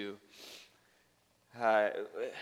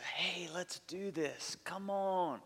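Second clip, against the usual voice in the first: excited plea; 4 semitones higher, 4 dB quieter, slower than usual. Retold or imitated. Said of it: "passionate plea".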